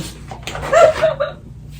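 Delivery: squeaky high-pitched giggle; silly falsetto giggle. Acted. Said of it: "Character mode".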